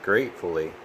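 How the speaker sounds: plain reading voice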